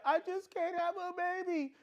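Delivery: high-pitched mock wail; mock crying. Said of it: "she was crying".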